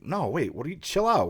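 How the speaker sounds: taken-aback neighbor, voice swooping up; defensive protest. Retold or imitated. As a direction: fend off an angry accusation. Neighbor defends himself to angry woman.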